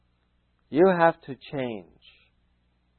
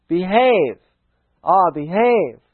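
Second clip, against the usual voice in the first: higher, louder, cheeky movie-spy drawl; Austin Powers impression; 4 semitones higher, 6 dB louder, slower than usual. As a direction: tease like a swinging sixties spy. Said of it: "I did an Artios on that one time".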